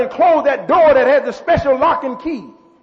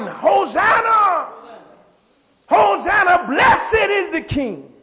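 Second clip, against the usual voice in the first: retold crowd shout; 6 semitones higher, slower than usual. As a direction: call it out slowly like a cheering crowd. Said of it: "And they're shouting".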